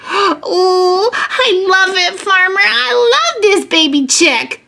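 put-on high, adoring little-kid voice; high-pitched, thrilled child; gushing delight. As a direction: gush over something tiny and cute. Child gushes over baby chick.

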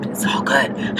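a soft whispered echo of the line; whispered repeat. Real, not acted. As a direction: breathe it again as a whisper. Said twice, second whispered.

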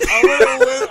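high loud laughing shout. Real, not acted. Friend shouts.

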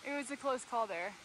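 winded after a steep climb; panting between words; out of breath. Real, not acted. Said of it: "but I'm barely breathing".

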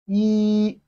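"yi" held long on one level high pitch; flat tone. Shown as a demonstration. Teacher models Chinese one for learners, flat-tone "yi". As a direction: sustain "yi" without any bend. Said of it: "It has a flat tone".

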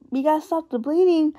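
ordinary talk

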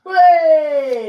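loud, high, drawn-out cheer; shouted greeting. Real, not acted. Co-host greeting a returning viewer, cheering shout.

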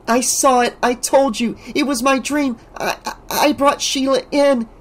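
high, shaken pleading; desperate to be believed; frightened girl. A narrator's reading. Alice telling friends in fear.

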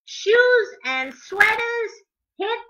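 animated picture-book reading; reading to children. A narrator's reading. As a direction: read the rhymes to little kids, bouncing on the beat.

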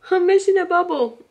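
loud, high startled realisation; hammed-up alarm at a mistake; mock dismay. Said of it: "I was like".

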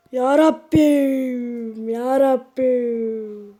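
drawn-out, very slow exasperated groan to heaven; exasperated plea. Real, not acted. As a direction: stretch it out as if begging for patience. Exasperated at the game.

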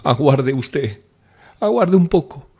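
Nastenka stammering through sobs. A narrator's reading. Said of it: "balbucía entre sollozos".

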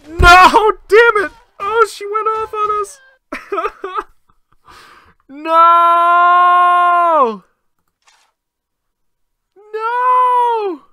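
high, loud yells of defeat; anguished shout. Real, not acted. Gamer yelling at losing the tournament fight. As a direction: scream no as the fight is lost.

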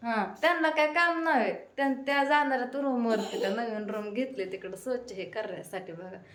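ordinary talk

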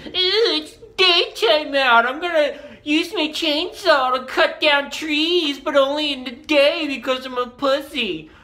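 very high, drawn-out mock wimp; mocking lame-ass voice. Ridiculing people who only saw by day, mocking. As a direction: squeal it slowly to ridicule a wimp. Mocking daytime chainsaw users.

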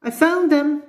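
high, loud storybook exclamation; crying out. A narrator's reading. Danny finding his runners under the bed, crying out. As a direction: sing out high as an acted boy's cry. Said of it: "he cried".

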